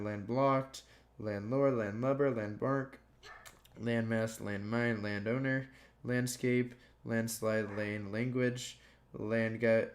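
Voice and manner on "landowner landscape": ordinary talk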